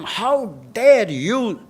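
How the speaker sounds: put-on indignant telling-off; irate umpire. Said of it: "using four-letter words on him".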